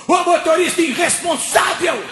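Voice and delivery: high-pitched scolding holler; crowd's indignant shout. Retold or imitated. Bus stop crowd scolds the driver, high indignant shout. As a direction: yell after the departing bus, outraged.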